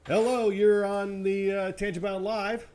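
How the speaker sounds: loud, slow phone greeting; shouting into a phone. Real, not acted. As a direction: call it out slowly into the phone. Answering a call on air.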